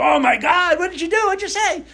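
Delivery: high, loud, shocked shout from the cousins. Retold or imitated. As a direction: yell it out like a room of stunned cousins. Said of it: "all my cousins go".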